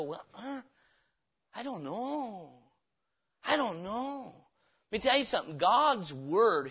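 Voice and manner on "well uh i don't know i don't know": soft, slow, shrugging I don't know; mock uncertainty. Preacher mimics an unbeliever, soft and unsure. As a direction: play a lost doubter trailing off. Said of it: "you go".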